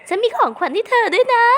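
very high, bright offer of a gift; sweet character voice. Pretend. (เสียงแหลมสูงหวานๆ ตอนให้ของขวัญ)

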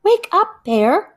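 high loud call to wake him; crying out. Acted. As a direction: shout him awake, pitch up high. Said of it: "cried Fox".

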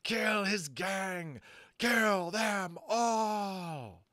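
high, very slow shrieking bird; furious devil bird screaming for blood; hellish shriek. A narrator's reading. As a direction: drag out the shriek from the top of the tower. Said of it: "Flag shrieked hellishly".

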